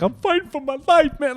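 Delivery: high, strained mock-desperate cry; hammed-up desperate wail; R. Kelly. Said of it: "he made those crazy videos of".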